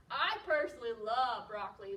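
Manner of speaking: ordinary delivery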